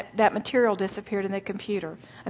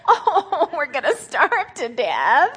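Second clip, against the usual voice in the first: slow, whiny fake crying; mock wailing Israelites; 4 semitones higher, slower than usual. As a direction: ham up the despair. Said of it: "the first little glitch".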